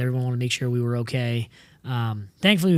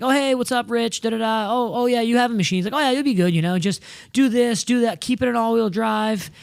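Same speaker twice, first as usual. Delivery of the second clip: lightly imitated easygoing staffer, pitched higher; breezy staffer; 7 semitones higher than usual. Demo-ride staffer briefing the driver, mock breezy. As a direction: breezily wave them through in a higher voice.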